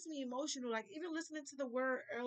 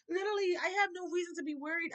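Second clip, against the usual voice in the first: quick, higher, laughing through it; 6 semitones higher, 5 dB louder, faster than usual. Real, not acted. Repeating to viewers she has no reason to worry, laughing. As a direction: laugh it off and rush the words.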